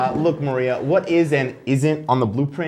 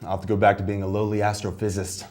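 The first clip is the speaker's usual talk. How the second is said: mock bitter loser; 9 semitones lower, 6 dB quieter than usual. Loser vows revenge.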